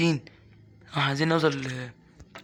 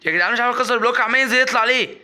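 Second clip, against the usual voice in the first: higher, louder exasperated yelling at the game; frustrated shout; 6 semitones higher, 5 dB louder than usual. Real, not acted. Gamer yelling at viewers that the block won't break, frustrated shout. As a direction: blurt it out fed up with the block.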